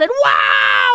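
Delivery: high, loud wow on the slide; thrilled yelp going down; excited shout. Real, not acted.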